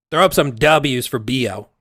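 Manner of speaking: ordinary delivery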